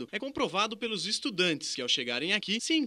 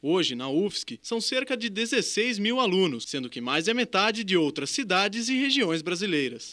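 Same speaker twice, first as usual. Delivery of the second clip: even, measured report read; news narration; 4 dB louder than usual. Acted. Reporter narrates the university feature in news voice. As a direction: present the facts to viewers.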